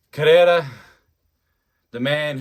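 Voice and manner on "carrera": Carrera called out high and loud; kick-off call. Real, not acted. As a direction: announce the name as play starts.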